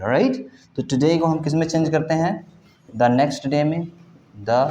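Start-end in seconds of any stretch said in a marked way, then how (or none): none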